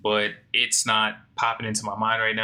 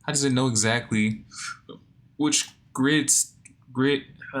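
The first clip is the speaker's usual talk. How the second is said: words stretched by a yawn; yawning while talking; 5 semitones higher, 3 dB louder than usual. Real, not acted. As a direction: talk through a big yawn. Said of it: "I need to stop yawning".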